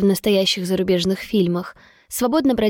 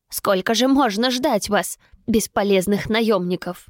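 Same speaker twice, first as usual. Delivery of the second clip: ordinary delivery, nothing marked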